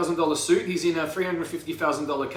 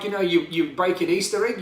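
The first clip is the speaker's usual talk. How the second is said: ordinary talk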